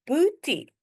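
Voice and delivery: "Boetie" in two careful, separated syllables; demonstrated pronunciation. Shown as a demonstration. Teacher shows learners how to pronounce "Boetie", syllable by syllable. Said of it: "Let me show you how to pronounce it".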